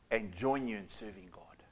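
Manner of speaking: whispered close of the point; hushed whisper to the congregation. Real, not acted. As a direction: drop to a whisper.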